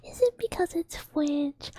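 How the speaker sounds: playful mock accusation; teasing. Repeated louder, higher tease.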